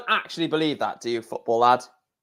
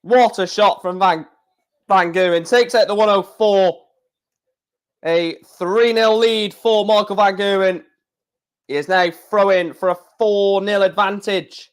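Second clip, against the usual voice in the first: commentator voice; 6 semitones higher than usual. Acted. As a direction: call it like the TV commentator. Mock darts commentary.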